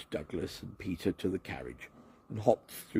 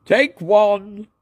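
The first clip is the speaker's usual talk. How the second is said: much higher, louder call; 13 semitones higher, 12 dB louder than usual. A narrator's reading. Neil calling the take, high and loud. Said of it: "called Neil".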